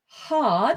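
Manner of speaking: hard with a clear R; teacher's model word. Shown as a demonstration. Teacher models hard for student, clear R. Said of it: "It's this word here".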